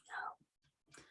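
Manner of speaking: a breathless, voiceless no; just breathe out the no; whispered no. Retold or imitated. Grandma vetoes the dress.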